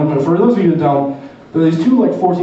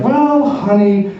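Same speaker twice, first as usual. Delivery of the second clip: melted soothing; 10 semitones higher than usual. Retold or imitated. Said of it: "I fucking melted".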